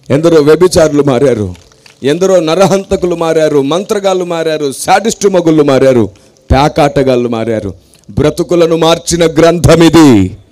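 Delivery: rising, ringing sermon cadence, hitting each line hard; preaching at full force. Acted. Preacher proclaiming his gospel to the congregation, full-force preaching. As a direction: preach it to the crowd, pounding the refrain.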